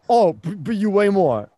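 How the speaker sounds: stumbling, wounded comeback, played up; mock hurt protest. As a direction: imitate a sore loser's excuse. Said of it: "Dane was so hurt when he's like".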